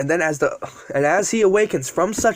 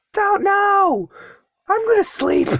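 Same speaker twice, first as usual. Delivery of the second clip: high-pitched shouted reply; calling back; slower than usual. Acted. Hero shouts back before passing out.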